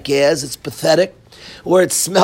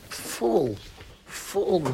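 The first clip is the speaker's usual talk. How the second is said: scornful whisper; 13 dB quieter than usual. Real, not acted.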